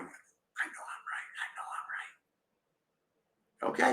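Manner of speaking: whispering it under the breath; mock whisper. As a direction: hush it like a stubborn secret. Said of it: "she's going".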